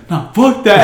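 loud, high mock outburst; hammed-up angry dismissal; acted defiant refusal. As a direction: imitate a hot-headed kid refusing to back down. Friend refuses to back down.

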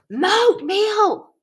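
put-on very high, loud childish shriek; kid shrieking. A narrator's reading. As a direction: scream it like a furious little boy.

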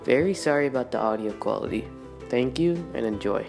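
tinny audio over music; recorded intro played back; re-recorded through speaker. Real, not acted. Intro clip over music, different source.

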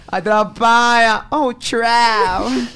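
hammed-up slow dramatic wail; dramatic cry. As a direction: stretch it like a soap heroine. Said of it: "atrapada son tres sílabas".

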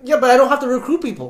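high, fast, loud through laughter; laughing retort. Retold or imitated. To the recruiting friend, laughing retort. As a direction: laugh out the comeback.

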